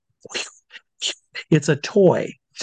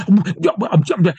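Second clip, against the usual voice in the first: imitated lost-for-words sputter, higher pitched; flustered stammer; 5 semitones higher, faster than usual. Marketing head proven wrong before walking away, flustered stammer. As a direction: stammer as if caught out. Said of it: "And he goes".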